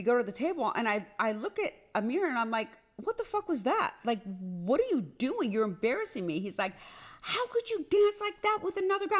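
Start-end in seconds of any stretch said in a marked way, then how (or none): 3.0-6.4 sharp high burst, then pressing him; exasperated confrontation. Retold or imitated. She confronts her boyfriend at the baby shower, exasperated and high. 7.2-9.2 high, mock-accusing jealous voice; jealous boyfriend. She mimics her jealous boyfriend accusing her over dancing, high and whiny.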